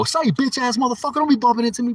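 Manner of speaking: high-pitched indignant confrontation; mock outburst. Man confronting someone who bumped him, mock-angry.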